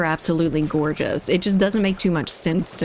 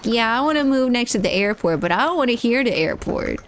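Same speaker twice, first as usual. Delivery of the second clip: higher, whiny mock of clueless homeowners; mocking entitled neighbours; 5 semitones higher than usual. Mocking airport neighbours who complain about noise, whiny and high. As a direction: parody someone who wants it both ways. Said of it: "people are like".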